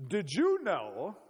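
slow, badly faked Afrikaner accent; attempted Afrikaner accent. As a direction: have a clumsy go at an Afrikaner accent. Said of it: "I can't do an Afrikaner accent".

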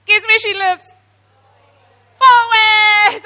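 high, loud shouted reveal; excited reveal. Real, not acted. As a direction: shout the punchline in delight. Punchline of the plane story.

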